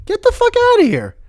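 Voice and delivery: very high-pitched astonishment; incredulous disbelief. Real, not acted. Learning there are Muslims in China, high squeal of disbelief. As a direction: squeal it, unable to believe it.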